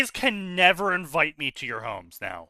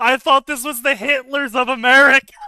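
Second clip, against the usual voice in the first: higher, louder mock innocence; mock apology; 5 semitones higher, 6 dB louder, faster than usual. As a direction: fake sorry, playing dumb.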